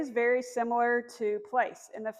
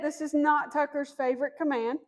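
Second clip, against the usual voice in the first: usual speaking voice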